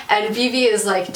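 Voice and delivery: normal talking voice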